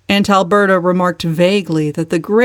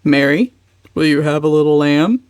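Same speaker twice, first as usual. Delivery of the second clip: put-on hearty old joke; 2 semitones lower than usual. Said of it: "made his hearty perennial joke".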